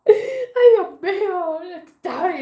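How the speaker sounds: exaggerated crying lament, laughing through it; mock sobbing. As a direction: blubber it out like a distraught hairdresser. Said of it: "está llorando, literalmente llorando".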